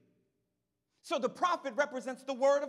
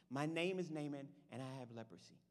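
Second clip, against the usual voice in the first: meek leper; 12 semitones lower, 12 dB quieter than usual. Retold or imitated. Sick visitor at the door.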